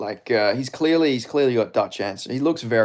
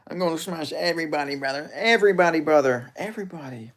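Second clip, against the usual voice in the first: higher, put-on fighter voice; Hamza; 5 semitones higher than usual. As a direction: put on a high, boastful impersonation. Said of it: "Do Hamza impression".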